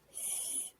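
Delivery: the letter "S" as a long unvoiced hiss; snake-like S sound. Shown as a demonstration. Teacher models the first sound for pupils, snake-like hiss on "S". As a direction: say "S" as one drawn-out sss, like a snake. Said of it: "like snake".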